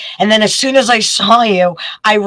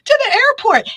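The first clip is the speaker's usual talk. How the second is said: high-pitched retold panic; panicked; 14 semitones higher than usual. Rushing from rehearsal to meet her boyfriend's flight, panicked and high. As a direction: squeal it like you just realized you're late. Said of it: "And I'm like".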